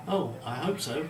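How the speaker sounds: slow, eager and loud hope. A narrator's reading. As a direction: say it with a boy's eagerness. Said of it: "said Thomas eagerly".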